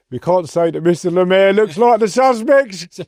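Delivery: high, loud voice cracking up with laughter; laughing. Real, not acted. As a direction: tell it through helpless, real laughter. Retelling funny courtroom story, laughing.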